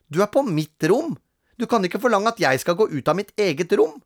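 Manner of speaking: high, fast, indignant protest; lightly voiced goody-goody boy; whiny little brother. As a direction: younger brother insisting on his rights. Peter protests in his voice.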